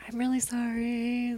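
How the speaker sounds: soft whispered sorry, voice gone to breath; whispered apology. Retold or imitated. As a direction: whisper the apology gently to the kids. Apologizing to kids next day.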